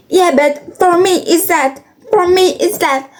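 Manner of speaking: insistent mock mother.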